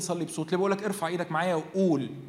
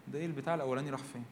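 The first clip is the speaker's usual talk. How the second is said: quick aside; 4 semitones lower, 10 dB quieter, much faster than usual. Pretend. Mock aside, quick and quiet.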